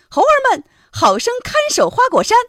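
high, projected call to the troops; rallying command. Acted. Sun Wukong ordering his monkeys before leaving the mountain, rallying.

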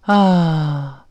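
open-mouthed sighing vowel; drawn-out sigh as a vowel demo; long demonstrated sigh "ah". Shown as a demonstration. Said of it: "就是我们像那个叹气一样".

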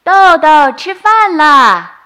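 high, loud call from the other room. Acted. Mother calling Doudou to dinner, loud and drawn out. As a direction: call him in for dinner across the house. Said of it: "只听妈妈喊".

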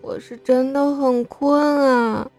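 slow, sulky, hard-done-by; aggrieved. A narrator's reading. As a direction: sound wronged and sleepy. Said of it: "苏荣也很委屈".

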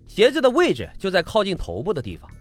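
ordinary delivery

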